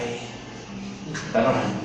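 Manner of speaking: slow unvoiced mock prayer whisper; mock whispered praying. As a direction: breathe it out like a hushed prayer. Mocking the group's praying.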